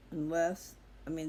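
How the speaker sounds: ordinary talk, nothing marked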